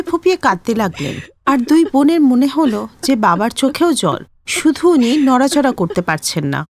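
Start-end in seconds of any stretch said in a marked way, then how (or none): none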